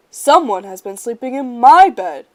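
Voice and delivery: loud papa bear bellow. A narrator's reading. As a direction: bellow over the bed. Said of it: "Papa Bear bellowed".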